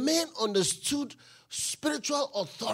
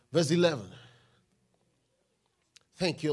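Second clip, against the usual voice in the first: ordinary delivery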